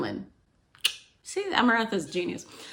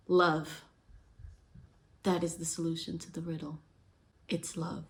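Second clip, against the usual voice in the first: softer and softer, breathing out the answer; soft realization; 3 semitones lower, 10 dB quieter than usual. Retold or imitated. Dying heroine quietly naming the answer to the riddle, fading soft. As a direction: let the word fade to almost nothing.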